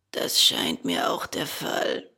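low, husky creature voice; deep hoarse reply. A narrator's reading. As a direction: rasp it low out of the dark. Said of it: "eine heisere Summe".

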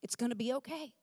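soft, unhurried reassurance with long pauses; Dallas reassuring. Retold or imitated. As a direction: imitate a calm husband, quiet and settled. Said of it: "Dallas told me".

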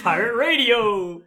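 loud, drawn-out pirate radio cry; excited shout. Real, not acted. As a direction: belt it out like an announcement. Joking exclamation about the podcast.

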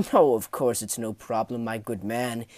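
chuckle, then falsely hearty reassurance; chuckling. Acted. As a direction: laugh it off loudly to start. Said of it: "and he chuckled".

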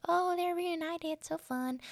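mock the sappy happy ending. Mocking the film's happy ending with a high, slow, sarcastic voice. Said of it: "and be like".

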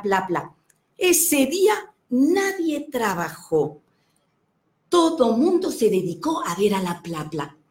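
lively, animated storyteller voice; playful children's storyteller; storytelling to children. Acted. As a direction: tell it like you're reading to a room of kids. Tells children's story on request.

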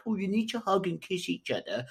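high, put-on instructor voice mocking posing orders; mock pushy photographer. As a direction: parody a bossy photographer telling couples to kiss. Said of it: "and be like".

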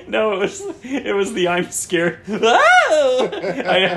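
high breathless laughing; laughing fit. Real, not acted. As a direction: laugh through the words. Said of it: "I just cracked myself up".

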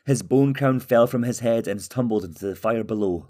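usual speaking voice

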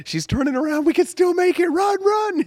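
put-on high, urgent, breathless kid voices; excited kids. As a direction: yell like kids racing for the bus. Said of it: "We're like".

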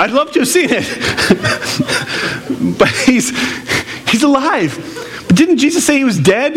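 delighted, laughing at the picture. Real, not acted. Preacher laughing at the image.

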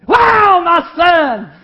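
huge, high, loud burst of joy with a laugh; joyful shout. Acted. Father spotting his lost son, overjoyed laughing shout. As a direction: cry out in delighted disbelief, laughing.

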